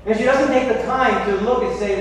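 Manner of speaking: normal talking voice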